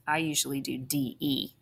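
ordinary talk

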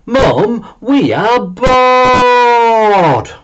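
high, drawn-out whiny moan.

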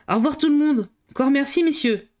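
high, loud and jovial; hearty farewell. A narrator's reading. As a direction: boom out a cheerful goodbye. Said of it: "il ajouta rondement". (au revoir jovial et sonore)

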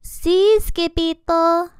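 high, loud little-dog voice; small character calling out high and loud; eager little chihuahua voice; panted. A narrator's reading. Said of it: "panted Poquito Tito".

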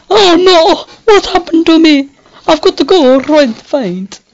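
high, loud hammed-up despair; theatrical distressed damsel; mock fainting heroine. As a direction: swoon as if about to faint. Playing fainting lover character.

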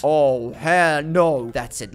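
very slow, drawn-out refusal; mock outrage. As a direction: stretch each word in hammed-up disapproval. Catches boyfriend selling drugs in game.

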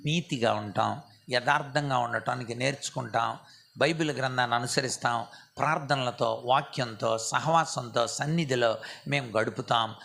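low, soft penitent prayer; humble confession. Shown as a demonstration. Preacher models repentance to God, soft and humble. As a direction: pour out a quiet confession. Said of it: "మన స్థితిని మనం ఒప్పుకోవటం".

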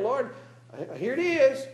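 high, loud mock servant and master; preacher voicing the one talent man and his Lord; mock servant then master.

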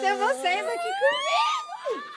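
high, loud, tearful outburst; real crying joy, voice breaking high; choked up crying to her guests; overcome with emotion. Real, not acted. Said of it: "eu tô tremendo".

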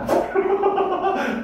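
high-pitched burst of laughter. Real, not acted. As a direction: crack up at your own joke, high. Teacher laughing at own joke. (높은 소리로 터지는 웃음)

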